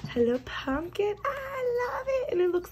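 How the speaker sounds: squealing high over the pumpkin; gushing. Real, not acted. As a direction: coo at it, thrilled. Gushing over fall cover.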